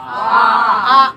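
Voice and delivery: आ held long and loud; loud sustained आ; long vowel demo. Shown as a demonstration. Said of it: "उच्चारण कीजिए आप लोग".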